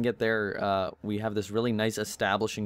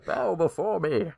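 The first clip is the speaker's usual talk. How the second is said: dark lord; 8 semitones higher, slower than usual. Pretend. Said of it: "There we go".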